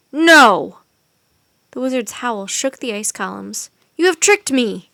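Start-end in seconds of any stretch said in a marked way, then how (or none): 0.1-0.8 roared. A narrator's reading. Furious wizard roars at the children, full voice. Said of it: "and roared". 4.0-4.9 angry accusation. Acted. Wizard accusing children.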